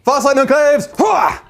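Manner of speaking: rousing battle cry, shouted; rally the troops with a cheer. Acted. Battle cry before dice roll.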